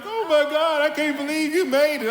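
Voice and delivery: high, loud overwhelmed disbelief; crying son. Retold or imitated. Son opening the door to his father, overwhelmed. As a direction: burst out in tearful surprise. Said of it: "he just started crying".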